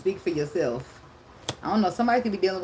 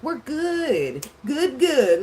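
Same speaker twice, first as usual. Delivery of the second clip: higher, mock crooned good good good; 8 semitones higher, 4 dB louder, much slower than usual. Mocking [name] singing over the crying woman, high and light. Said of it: "he's like".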